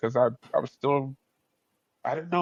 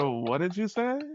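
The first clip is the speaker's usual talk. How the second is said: higher, put-on shocked voice; stunned disbelief; 6 semitones higher, slower than usual. Student stunned when a stranger calls his friend cute, pitched-up disbelief. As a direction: ask like you misheard something huge.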